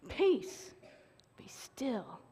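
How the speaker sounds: quiet, steady authority; soft and sure; calm command. Retold or imitated. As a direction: hush the storm gently. Jesus calming the storm.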